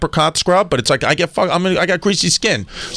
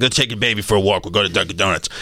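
calm dad; 6 semitones lower than usual. Retold or imitated.

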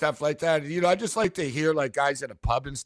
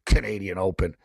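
a low, scoffing "Pff, Canadian Open"; dismissive bettor; 11 semitones lower than usual. Retold or imitated. Cape Town punter scoffing at the board, low and dismissive. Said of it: "he goes".

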